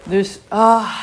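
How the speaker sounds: right after a sip of water; refreshed after a sip; while sipping a drink. Real, not acted. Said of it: "ik neem even een slokje water".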